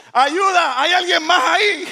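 very high, loud cry; comic shout for help. Acted. Jack to anybody else up there, shouting comically. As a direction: yell it out as the punchline. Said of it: "se escucha la voz de Jack diciendo".

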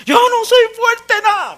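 high, slow tearful wail; crying mother. Retold or imitated. Mother at husband's burial, crying to her son. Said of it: "me decía llorando".

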